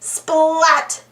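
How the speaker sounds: ordinary delivery, nothing marked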